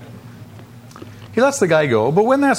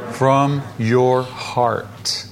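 "From your heart" very slow, each word apart; weighty, spaced-out "From your heart"; deliberate emphasis; 3 semitones lower, much slower than usual. Shown as a demonstration. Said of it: "What are the next three words?".

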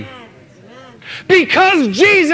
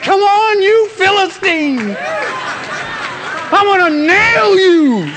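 excited battle cry; 7 semitones higher, slower than usual. Acted. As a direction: shout it like a fighter itching to charge. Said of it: "He's excited".

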